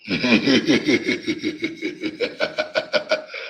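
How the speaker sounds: real amused laughter; long laugh. Real, not acted.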